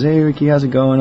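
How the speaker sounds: high, loud greeting; manager's greeting. Retold or imitated. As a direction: brightly hail a regular caller. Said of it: "she always goes".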